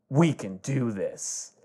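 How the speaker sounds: soft, slow reassurance; quietly steady a scared friend; hushed, heartfelt encouragement; tender resolve. Acted. Tender reassurance to Ninja Brian.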